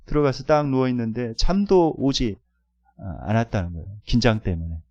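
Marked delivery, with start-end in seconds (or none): none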